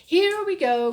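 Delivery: loud, high excited cry; the cat kicking off the night trip with a shout; cried out. A narrator's reading. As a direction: call it out to start the adventure. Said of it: "cried the cat".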